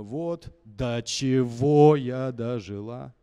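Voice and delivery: slow, loud, sung reproach; furious accusation. Shown as a demonstration. Coach showing how to attack Grigori with the line. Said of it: "you can even beat him with this Grigori".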